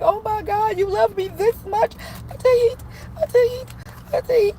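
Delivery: high-pitched mock weeping, overwhelmed; imitated tearful crying. Girl overwhelmed by so much love, mock crying high. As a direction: squeak it out like a happy sob. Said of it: "I was crying and snotting all over the place".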